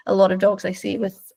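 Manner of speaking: normal talking voice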